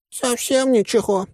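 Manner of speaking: high, drawn-out disappointed whine; upset. A narrator's reading. Green told not to blow things up, whining upset. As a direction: whine it, pitch far up. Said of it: "расстроился Зелёный".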